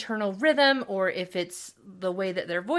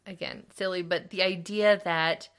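usual speaking voice

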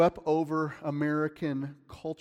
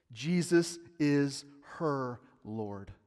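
slow, weighty; much slower than usual. Real, not acted. Preacher drives home the point, slow and solemn. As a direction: let each word land.

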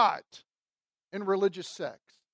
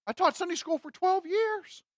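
imitating a churchgoer in a much higher voice; mock self-justification; 9 semitones higher than usual. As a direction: offer it as proof, pitched way up. Imitates the answer of someone questioned.

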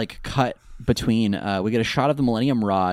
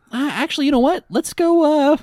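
high, louder put-on voice; mock group chatter, pitched way up; easily swayed gang; 13 semitones higher, 4 dB louder than usual. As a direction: mimic a gang suddenly changing plans.

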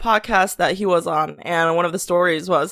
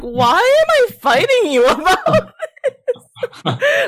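high, slow, laughing exasperation; laughing disbelief; 4 dB louder, much slower than usual. Retold or imitated. Retelling her own exasperated reply to the coworker, laughing.

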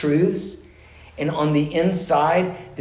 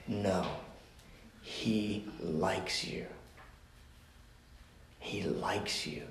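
very slow, low, near whisper; hushed insistence; 6 semitones lower, 16 dB quieter, much slower than usual. Retold or imitated. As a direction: let each repeat sink in, fading to a whisper. Repeating it until the client melts.

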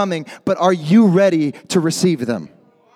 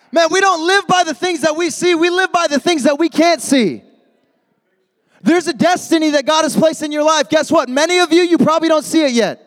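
preaching at full force; 8 semitones higher than usual. Acted. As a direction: drive it home to the youth crowd. Preaching climax.

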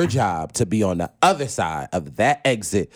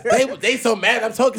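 ordinary delivery

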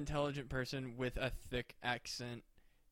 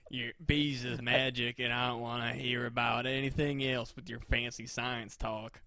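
mock Southern drawl, slow to start; dumb Southern voice; 3 semitones lower, slower than usual. Scene: refusing to hear fancy science talk, dumb Southern voice. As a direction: play a dim Southern science-doubter. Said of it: "use Southern accents as the dumb voice".

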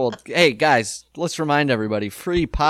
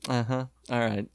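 softer, resigned uh-huh; resigned mumble; 5 semitones lower, 9 dB quieter than usual. Retold or imitated. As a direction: mumble like he saw it coming. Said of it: "He just turns around like".

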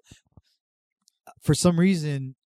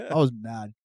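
usual speaking voice

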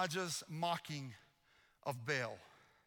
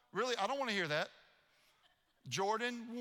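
normal talking voice

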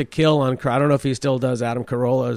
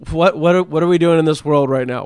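usual speaking voice

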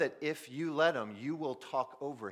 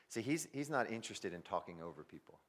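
normal talking voice

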